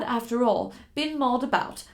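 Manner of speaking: usual speaking voice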